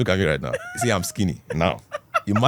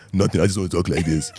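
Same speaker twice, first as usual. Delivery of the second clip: lightly lowered, forced baritone; put-on deeper voice; 3 semitones lower than usual. Schoolboy answering classmates in his forced deep voice. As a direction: push the voice down into a fake baritone. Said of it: "how far you doing".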